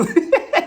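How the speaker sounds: sudden loud high laugh; high burst of laughter. Real, not acted. Vlogger mocking the reunion drama, a loud high laugh. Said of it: "sincere until that reunion happens".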